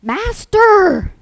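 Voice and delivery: high, loud cry for help; panicky yell. Acted. Disciples waking Jesus in the storm, panicky yell. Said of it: "they were panicky and yelled".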